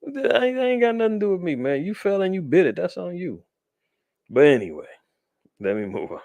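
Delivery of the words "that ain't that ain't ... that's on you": high-pitched scoffing brush-off, fast in the middle; high mocking retort. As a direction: laugh him off in a squeaky mock voice. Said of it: "I'm like".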